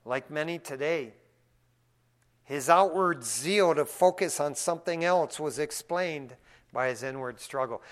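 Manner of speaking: usual speaking voice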